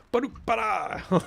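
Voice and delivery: high, drawn-out mock dwarf shout; mock battle cry. Player mimicking the game's dwarven shouts, mock battle cry. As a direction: imitate a garbled war cry, high and stretched. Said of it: "so much shouting and yelling".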